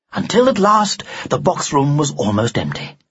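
ordinary delivery, nothing marked

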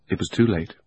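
ordinary delivery, nothing marked

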